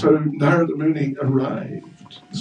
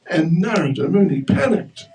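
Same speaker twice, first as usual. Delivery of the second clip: ordinary delivery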